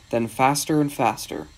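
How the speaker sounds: ordinary delivery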